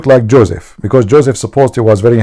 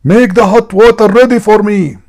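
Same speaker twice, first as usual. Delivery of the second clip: high, loud bossy husband yell; retold scream; 11 semitones higher, 5 dB louder than usual. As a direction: bark the order at the wife, high and loud. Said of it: "he screamed".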